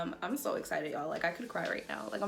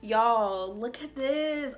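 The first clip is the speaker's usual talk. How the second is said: excited; 3 semitones higher, 7 dB louder, much slower than usual. Real, not acted. Showing off the bright light.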